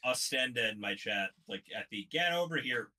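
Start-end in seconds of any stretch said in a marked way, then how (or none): 2.1-2.9 scorpion quote. Retold or imitated. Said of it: "insert scorpion quote here".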